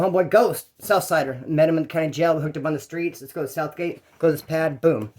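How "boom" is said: ordinary delivery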